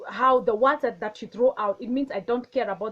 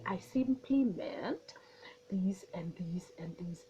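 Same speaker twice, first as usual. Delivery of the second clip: whispered, slow clarification; hushed explanation; 4 semitones lower, 9 dB quieter, slower than usual. Shown as a demonstration. Calmly clarifying a misunderstanding to someone upset, whispered. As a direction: whisper it out gently and slowly. Demoing assertive calm explanation.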